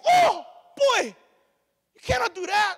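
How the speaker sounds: loud, high shouting auntie; scolding auntie. Retold or imitated. Auntie shouting a warning at the boy. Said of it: "one of the aunties like".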